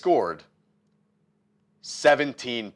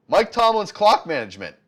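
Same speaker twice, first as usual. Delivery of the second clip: loud, high, angry ranting; 9 semitones higher, 8 dB louder than usual. Real, not acted. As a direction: spit out the complaint. Ranting about the coach.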